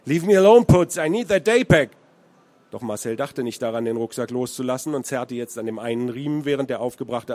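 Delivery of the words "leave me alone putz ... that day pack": very loud, higher American English; furious yell. A narrator's reading. American fighting the teenager for the pack, furious yell. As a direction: roar at the teenager to let go.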